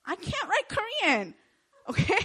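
high, laughing protest. Real, not acted. Intern laughing about the boss's demands. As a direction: laugh it off in disbelief.

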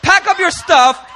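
God hurrying Moses along, loud; impatient God. Retold or imitated. God ordering Moses to pack up, loud impatient command. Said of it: "God said".

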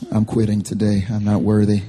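low, defeated self-pity; mock shame. Pastor handing over bad grades, mock shame. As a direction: drop the pitch and give up, lightly hammed-up. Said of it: "I could feel this shame".

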